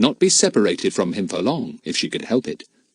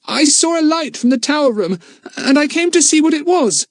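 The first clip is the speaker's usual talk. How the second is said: high, loud frightened blurt; frightened boy; 5 dB louder than usual. Acted. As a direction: blurt it out in a panic. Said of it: "Too frightened to think".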